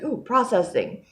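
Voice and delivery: high, slow, mock-eager ooh; body's voice. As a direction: voice the body happily processing. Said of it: "my body's like".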